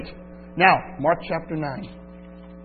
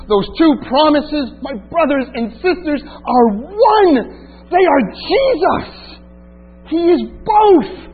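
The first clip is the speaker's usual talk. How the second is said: rising, fervent preaching, slowing on the key words; preaching climax; 6 semitones higher, 3 dB louder than usual. Acted. Preacher telling his brothers and sisters Jesus is both, fervent. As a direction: build to the peak of the sermon.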